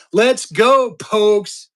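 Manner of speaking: loud, high rallying cheer; team cheer. Real, not acted.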